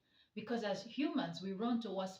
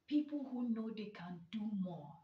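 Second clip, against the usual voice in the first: ordinary talk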